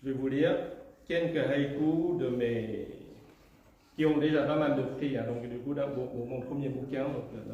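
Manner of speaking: ordinary delivery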